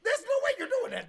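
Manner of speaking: loud, high, half-laughing protest; outraged disbelief. Retold or imitated. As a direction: exclaim it in incredulous outrage.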